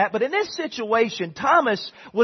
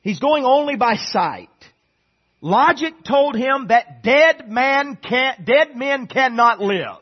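louder, slow, deliberate preaching; preaching emphasis; 5 semitones higher, 7 dB louder, slower than usual. Acted. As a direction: hammer it home from the pulpit. Preaching climax on logic.

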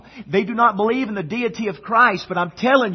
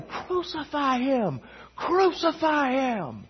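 higher, slow, drawn-out mob chant; voicing the mob; 7 semitones higher, 6 dB quieter, slower than usual. Preacher acting the angry mob yelling at Pilate, high and drawn-out. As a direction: paint the mob chanting from far off.

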